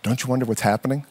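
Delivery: soft, low, quick question; hushed retold wondering. Wife to husband about silent kids, hushed. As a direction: ask it low, as if keeping a secret.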